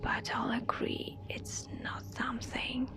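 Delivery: usual speaking voice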